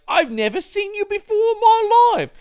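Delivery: very high, loud mock woman; hammed-up female impression; falsetto woman voice. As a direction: squeak it like a cheesy actress. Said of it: "she's like".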